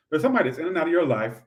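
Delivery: ordinary talk, nothing marked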